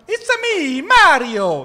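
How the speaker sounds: high, squeaky video-game plumber cry; Italian cartoon plumber, leaping in pitch; Mario impression. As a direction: pop out the catchphrase like Mario. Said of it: "c'est Mario Bros".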